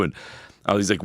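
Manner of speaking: ordinary talk, nothing marked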